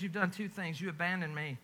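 quiet, low, grieving; a husband to his faithless wife, hushed; hurt husband. Acted. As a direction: let the accusation sink, barely voiced. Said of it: "He says".

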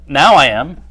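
loud, indignant acted retort; mock upset. Answering someone who asked if they're upset, mock indignant.

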